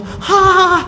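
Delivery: very high, startled "haa"; imitated shocked gasp. Woman learning she rebuffed the Prophet, imitated high shocked cry. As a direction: gasp in mock horror, pitched high.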